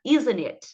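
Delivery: ISN'T IT linked as "izn-it", falling; swallowed T. Shown as a demonstration. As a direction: eat the T in "isn't it". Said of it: "meio que vou comer esse T". (T engolido)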